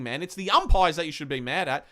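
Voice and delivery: ordinary talk, nothing marked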